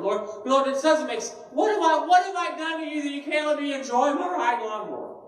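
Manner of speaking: high, pleading complaint to the Lord; mock-aggrieved prayer, pitched high; exasperated plea to God. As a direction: whine at heaven, half joking. Pastor voices his lawnmower complaint prayer.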